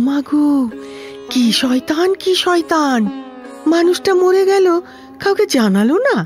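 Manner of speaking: high-pitched scandalised lament; indignant outrage. Acted. Outraged at concealed death. (উঁচু গলায় ক্ষুব্ধ আক্ষেপ)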